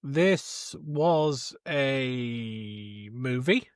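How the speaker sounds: drawn-out, dry deadpan verdict; slow deadpan. Real, not acted. To friends about the film, slow dry deadpan. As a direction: let each word hang, unimpressed.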